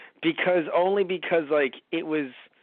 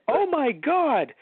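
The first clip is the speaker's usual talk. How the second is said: mock amazement, higher; drawn-out, higher exclamation; high slow exclaim; 10 semitones higher, slower than usual. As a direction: stretch out a high oh my God. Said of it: "it's just like".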